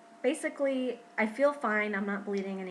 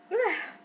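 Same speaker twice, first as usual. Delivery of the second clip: heavy sigh; 12 semitones higher, 5 dB louder than usual. Real, not acted. Tired sigh between topics.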